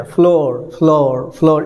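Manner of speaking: फ्लोर said as flor, Indian style; Indian pronunciation. Shown as a demonstration. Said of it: "Indian pronunciation में". (Indian उच्चारण में फ्लोर)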